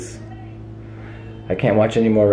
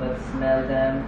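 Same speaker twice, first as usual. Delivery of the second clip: alien robot voice; 7 dB quieter than usual. Pretend. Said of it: "She is an alien robot".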